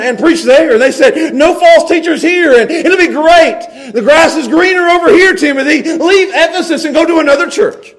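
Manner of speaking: loud, high, exasperated Timothy wanting out; whining quitter. Acted. Timothy complaining to Paul, loud fed-up voice. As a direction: throw up your hands, fed up.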